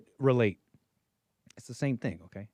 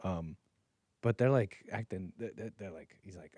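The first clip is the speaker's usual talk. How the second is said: ordinary talk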